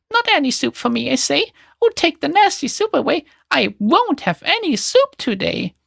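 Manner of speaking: high, petulant whine, slowing at the end; a stubborn boy wailing his refusal; mock child cry. A narrator's reading. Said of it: "the naughty fellow cries out still".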